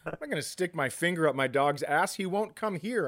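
usual speaking voice

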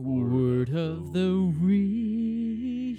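very slow, loud drawn-out show intro; jingle announcement. Acted. Host announcing the segment in a slow, loud drawn-out call. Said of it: "segment jingle".

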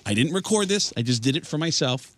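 ordinary delivery

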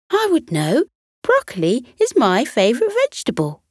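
high, fast and proud; proud Potato. Acted. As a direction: rattle it off proudly.

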